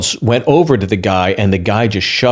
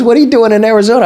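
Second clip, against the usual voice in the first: excited stranger; 11 semitones higher, 5 dB louder, faster than usual. Retold or imitated. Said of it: "He goes".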